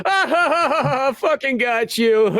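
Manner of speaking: gloating villainous princess laugh; mocking princess cackle. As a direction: cackle at him as the princess who tricked him. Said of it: "she just starts, like, laughing".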